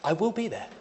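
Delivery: acted-out high reassuring voice; urgent reassurance. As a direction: talk someone down from freaking out. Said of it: "he's freaking out".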